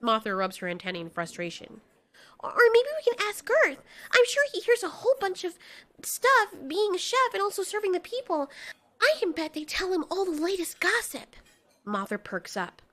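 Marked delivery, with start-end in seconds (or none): 2.4-11.4 put-on eager young Marley. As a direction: pitch a new plan to friends. Marley suggests asking Girth.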